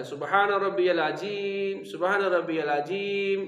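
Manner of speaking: العظیم said as عجیم with a j sound; village mispronunciation. Shown as a demonstration. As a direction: imitate the villagers saying عجیم. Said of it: "عظیم کو عجیم پڑھا جا رہا ہے". (العظیم کو عجیم بولیں)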